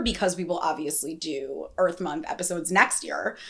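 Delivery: normal talking voice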